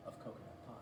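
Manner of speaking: ordinary delivery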